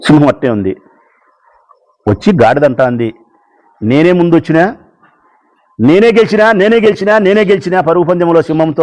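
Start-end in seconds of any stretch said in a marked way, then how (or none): 3.8-8.8 boastful donkey yelling. Acted. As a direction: crow about winning like a show-off donkey. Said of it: "గాడిద ఎంత కేకలేస్తాంది".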